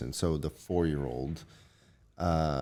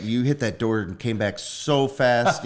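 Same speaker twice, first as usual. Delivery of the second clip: loud, raised, drawn-out praise; celebrating; 6 semitones higher, 5 dB louder than usual. Acted. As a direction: cheer a kid on with slow, emphatic amazement. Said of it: "is celebrating it, like, afterwards".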